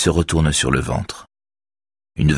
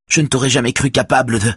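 voice choking; 9 semitones higher, faster than usual. A narrator's reading. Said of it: "Sa voix s'étrangle".

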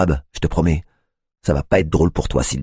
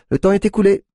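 curt; 5 dB louder than usual. A narrator's reading. Tiffany ends talk.

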